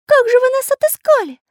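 very high, loud piping voice; dejected little artist. A narrator's reading. Little artist asks pirates how they found them, high-voiced. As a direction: ask helplessly in a high character voice. Pencil's voice, questioning captors.

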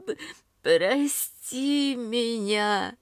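put-on drunk crying. A narrator's reading. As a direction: sob it out, drunk and sorry. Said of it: "Пьяно всхлипывает она". (пьяные всхлипы)

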